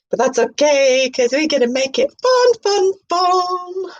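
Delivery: high, airy parody of a mystic presenter; mock spiritual host. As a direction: announce it like a dreamy new-age show host. High cheerful persona.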